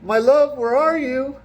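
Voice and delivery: high, loud longing call; lovesick damsel. Retold or imitated. Woman calling after fled guard, high mock call. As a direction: call out for a lost lover. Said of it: "And she's like".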